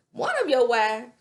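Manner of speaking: loud, high disbelief; shocked mock question. [name] stunned at the marriage contract. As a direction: shriek it in disbelief.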